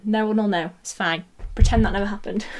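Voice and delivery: usual speaking voice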